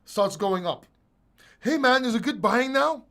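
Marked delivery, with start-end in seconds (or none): 1.6-3.0 retold message. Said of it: "I send the message".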